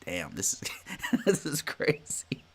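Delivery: hushed, breathy aside with a stifled laugh; retold whisper. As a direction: whisper it like you're hiding from the customer on the call. Retold shocked whisper in meeting.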